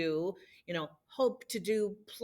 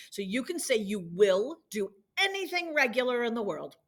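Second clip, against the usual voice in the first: ordinary delivery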